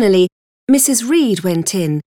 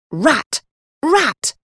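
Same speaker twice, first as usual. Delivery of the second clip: mild narrated shout, jumping higher; 6 semitones higher than usual. A narrator's reading. Boy attacking his cousin, mildly shouting. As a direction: call out the insult in two short bursts. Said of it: "pulling my hair and shouting".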